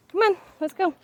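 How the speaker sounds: coaxing call. Real, not acted. Calling to pet outside.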